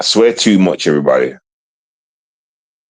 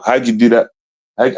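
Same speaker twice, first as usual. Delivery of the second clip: ordinary talk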